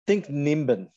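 usual speaking voice

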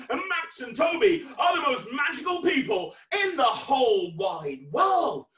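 high, shouted grand announcement; showman's proclamation. Real, not acted. Announces birthday boys as most magical.

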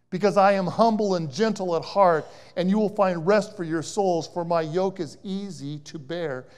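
forceful scripture reading. Acted. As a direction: proclaim it to the whole room.

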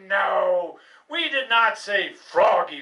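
normal talking voice

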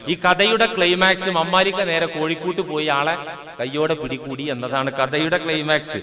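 usual speaking voice

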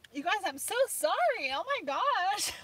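high wavering tearful apology; crying. Real, not acted. Apologising to viewers for crying on the stream, tearful. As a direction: apologise through real tears and a laugh. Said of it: "'cause I'm crying".

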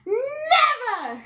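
high, loud "Never"; flat refusal. Acted. Fierce woman refusing pirate, high and loud. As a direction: slam the door on it with one word. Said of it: "she said".

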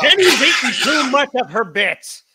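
high, loud shouting impression; hammed-up squawking shout; Gilbert Gottfried. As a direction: yell it right into someone's ear. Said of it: "sounds like Gilbert Gottfried shouting in Mike's ear".

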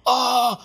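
high, loud startled yelp; scared yelp. Retold or imitated. Surfer startled at the bottom of the wave, high yelp.

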